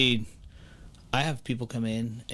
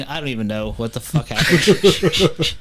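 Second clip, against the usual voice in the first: laughing disbelief; 2 semitones higher, 8 dB louder, slower than usual. Real, not acted. Laughs at own cash mess.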